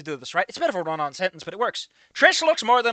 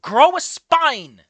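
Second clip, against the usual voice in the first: louder and slow, spitting scorn; scornful shout; 4 semitones higher, 7 dB louder, much slower than usual. Real, not acted. Reviewer yelling at the timid writer, scornful. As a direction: yell it like an order.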